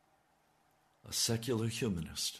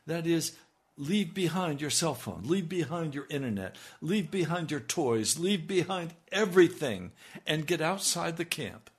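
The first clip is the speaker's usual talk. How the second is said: raised, fervent preaching; 6 semitones higher, 4 dB louder than usual. Acted. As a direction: rouse the congregation. Fervent exhortation.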